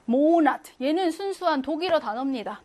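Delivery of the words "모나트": 모나트 with a loud, drawn-out 모; stress on first syllable. Shown as a demonstration. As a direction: punch the 모 of 모나트 hard. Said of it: "첫 번째 강세 빡 준다고 했잖아". (모나트의 첫 음절 모에 강세 빡)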